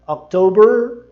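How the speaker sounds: October americano alto; American October. Shown as a demonstration. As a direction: stretch the R in October. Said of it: "Percebe a diferença?".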